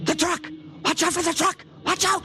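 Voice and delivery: high, urgent warning shout. Acted. Unseen voice warns a man crossing the street, urgent high warning. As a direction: yell it to stop him stepping out.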